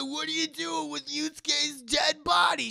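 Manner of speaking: high, loud alarmed yell; freaking out. Acted. Kuwabara freaking out at them carrying Yusuke's body. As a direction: panic at the sight of a corpse. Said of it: "Kuwabara's freaking out".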